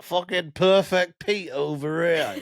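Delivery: slow, mock Northern impression; generic Northern accent. Host imitating the co-host for laughs, Northern accent. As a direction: put on a broad Northern accent. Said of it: "your impression of her as just generic Northern".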